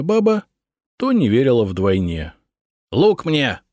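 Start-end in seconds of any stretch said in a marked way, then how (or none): 2.9-3.6 higher, sharp barked order; commanding. A narrator's reading. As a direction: snap the command to archers. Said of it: "скомандовала она".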